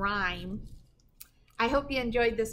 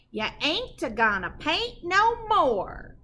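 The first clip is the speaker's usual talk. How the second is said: mock scolding holler, a bit louder; mama hollering; 5 semitones higher, 4 dB louder, slower than usual. Mama hollers at her son for painting the walls, mock scolding holler.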